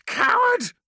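furious high scream. Acted. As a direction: hurl the insult at full voice. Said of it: "screamed Efrix".

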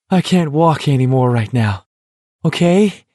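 high, dazed smiling plea; trusting smile. A narrator's reading. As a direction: smile through the shock, trusting. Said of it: "with a trusting, shell-shocked smile".

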